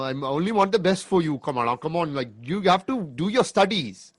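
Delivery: pleading like a fussy father; imitating her dad. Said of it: "your dad, you know, go like".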